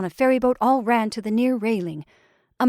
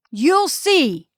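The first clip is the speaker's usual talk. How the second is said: raised, loud warning; exasperated threat; 6 semitones higher, 6 dB louder than usual. A narrator's reading. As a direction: insist on the threat, pitched up. Repeated threat in a quarrel.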